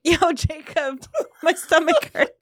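giggly imitation of a mom calling her husband; put-on mom voice. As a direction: whine it slowly, half laughing. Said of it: "I don't think that's the accent they have".

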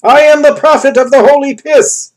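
very high, very loud screech. A narrator's reading. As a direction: shriek it to the hills.

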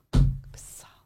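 a hushed, irritated aside; whispered annoyance. Retold or imitated. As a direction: mutter it under the breath, annoyed.

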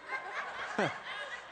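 a short, breathless laugh; breathy laugh. Real, not acted. Preacher laughing off his own bowling joke, breathy laugh. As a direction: laugh it off at yourself.

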